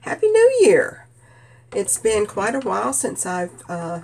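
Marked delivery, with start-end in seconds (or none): 0.0-1.1 cheerful greeting. Real, not acted. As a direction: call out a big New Year's greeting. Cheerful holiday greeting to viewers.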